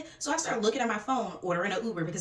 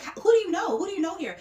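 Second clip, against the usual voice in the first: prying question; 9 semitones higher, 5 dB louder than usual. Retold or imitated. Girl questions the guest, acted.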